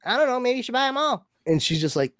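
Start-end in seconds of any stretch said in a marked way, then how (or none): 0.0-0.4 very high-pitched little voice; squeaky-high rapper impersonation. Retold or imitated. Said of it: "have that, like, high voice". 0.4-1.2 very high-pitched little voice; squeaky-high rapper impersonation. Retold or imitated. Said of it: "have that, like, high voice".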